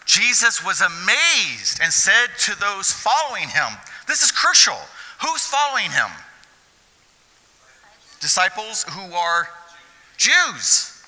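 loud, high, impassioned preaching; fiery pulpit climax; preaching at full force. Acted. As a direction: preach it out to the back row.

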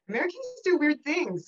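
lightly mocked parental puzzlement; imitating bemused parents. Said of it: "they tell me".

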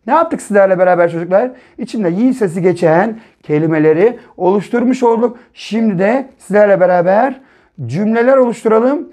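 ordinary talk

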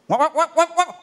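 high, loud rattling wak sounds; sewing machine noise. Pretend. Comedian imitating a racing sewing machine, loud rattle.

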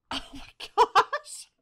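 high, loud burst through laughter; laughing oh my gosh; laughing gasp. Real, not acted. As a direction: crack up mid-exclamation. Laughing at the game voice.

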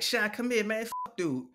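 high impatient call that drops off. Retold or imitated. Buddy losing patience calling him over. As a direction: urge him over, getting fed up. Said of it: "And dude is like".

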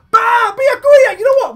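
high, loud, drawn-out exclamation; excited outburst. Real, not acted. Player reacting as the next character appears, excited outburst. Reveal of the third pull.

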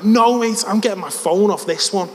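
put-on high, loud Scouse accent; defiant Scouse. Lad refusing to leave with his mates, loud Scouse. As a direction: refuse stubbornly in broad Scouse. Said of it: "give you a glimpse of the scouse".

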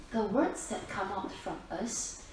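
usual speaking voice

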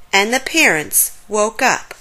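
ordinary delivery

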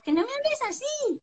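put-on very high, childish scolding; whining like the child. Mother answering her whiny child, mimicking childish high whine. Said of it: "hablando con el niño".

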